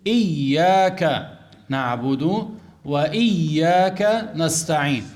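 إياك drawn out very slowly, ya doubled and held. Shown as a demonstration. Teacher showing students the doubled ya in إياك. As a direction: recite إياك نعبد وإياك نستعين very slowly, pressing the doubled ya. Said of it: "لابد أن يشدد الياء".

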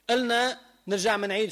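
ordinary talk

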